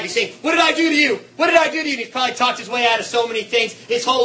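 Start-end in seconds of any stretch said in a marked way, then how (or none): 0.4-1.9 high, loud desperate plea, repeated; pleading in terror. Retold or imitated. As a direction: beg for your life, shouting high. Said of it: "He's crying, he's, for his life".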